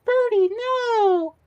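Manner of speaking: very high, slow squeal of a cartoon girl; Ruby impression. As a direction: squeal it like a cartoon girl scolding her pet. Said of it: "was actually Ruby's".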